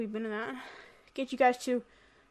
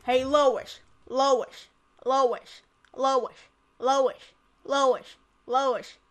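cartoon dad calling his wife over and over; repetitive, insistent calls, each falling the same way; Peter; 4 dB louder, much slower than usual. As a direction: nag with the name like a goofy cartoon husband. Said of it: "I can do Peter".

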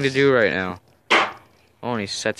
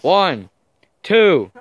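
referee count; 5 semitones higher, 7 dB louder than usual. Acted. Calling the pin count.

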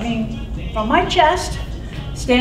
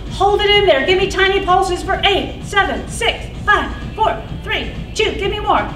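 high, pumped-up counting cues; aerobics instructor; 7 semitones higher than usual. Acted. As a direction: hype the class through every count. Counts pulses in class.

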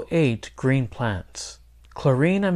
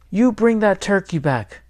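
higher, contained storybook shout; 7 semitones higher than usual. A narrator's reading. Girl to the boy running off with the turkey, shouting. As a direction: demand it in a moderately raised voice. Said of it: "shouted Clarín".

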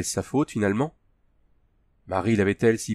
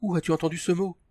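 shocked; 8 semitones higher than usual. A narrator's reading. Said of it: "octa-t-elle".

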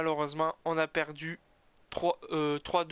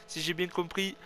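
ordinary talk

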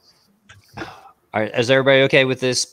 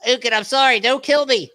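pleading; 9 semitones higher than usual. Acted. Pleading after the urine prank.

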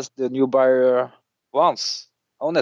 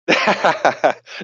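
hearty laugh; 6 semitones higher than usual. Real, not acted. Laughs at own joke.

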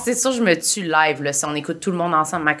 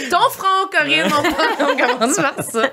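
high, laughing tease through giggles; 11 semitones higher, slower than usual. Real, not acted. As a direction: tease her while still laughing. Teasing suggestion amid group laughter.

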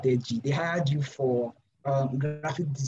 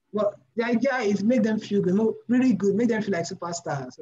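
higher, louder, driving the point home; emphatic; 7 semitones higher, 5 dB louder than usual. Real, not acted. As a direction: insist that it's really good.

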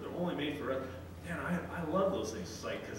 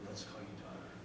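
tender father; 4 semitones lower, 9 dB quieter than usual. Retold or imitated. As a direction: comfort a lost child gently. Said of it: "He says".

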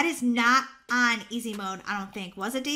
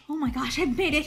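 normal talking voice

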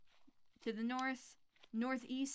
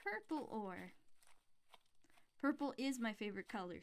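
ordinary talk, nothing marked

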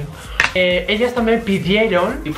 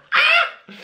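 short yelp. Acted. Grito de inicio del vídeo.

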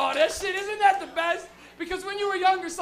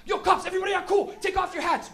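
high loud urgent order; urgent warning; 6 semitones higher, 7 dB louder than usual. Retold or imitated. Friend warning the car, loud panicked order. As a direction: hiss the order fast in alarm.

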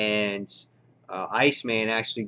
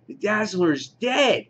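high, slow stunned gasp of disbelief; mock horror at a shock twist; reacting like a fan gutted by the death; 9 semitones higher, slower than usual. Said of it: "and you're just like".

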